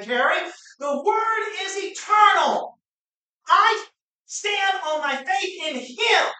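loud, rising preacher's cry; preaching at full force. Real, not acted. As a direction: preach it out to the whole church.

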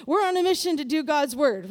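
usual speaking voice

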